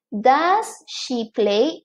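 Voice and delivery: slow, careful classroom example; teacher modelling a question slowly; slow model sentence. Shown as a demonstration. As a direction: lay each word out slowly for learners. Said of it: "teacher models example question".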